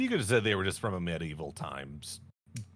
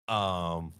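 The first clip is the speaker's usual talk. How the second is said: ordinary delivery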